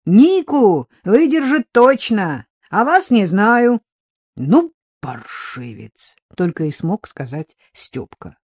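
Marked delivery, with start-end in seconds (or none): 0.1-3.8 loud, high, drawn-out call; raised, stretched-out holler; shouting. A narrator's reading. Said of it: "подёргал её и крикнул". 4.4-6.2 low, slow indignant curse; growing outrage on drawn-out words; stunned, seething exclamation; exasperated fury. A narrator's reading. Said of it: "не менее взбешённый Егор".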